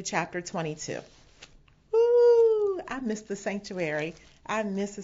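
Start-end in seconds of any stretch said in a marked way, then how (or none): 1.9-2.8 loud, long, high-pitched whoop; high "ooh". Real, not acted. Preacher missing the excited sanctuary, high wistful whoop. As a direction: let out a sustained high "ooh".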